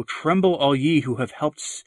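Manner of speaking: normal talking voice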